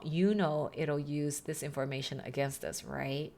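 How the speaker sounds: ordinary delivery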